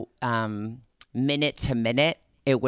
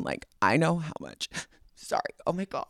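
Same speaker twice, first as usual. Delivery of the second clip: soft, tearful apology; choked up; 3 semitones higher, 6 dB quieter, faster than usual. Real, not acted. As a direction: voice giving out with emotion. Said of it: "I'm getting choked up".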